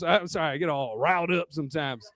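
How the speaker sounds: ordinary delivery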